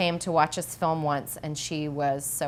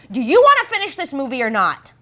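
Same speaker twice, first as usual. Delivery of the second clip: impatient director; 8 semitones higher, 10 dB louder than usual. Retold or imitated. Director challenging actor, retold.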